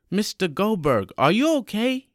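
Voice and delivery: high, rising worried question; alarmed concern. Acted. Roland to coughing sick man, alarmed concern.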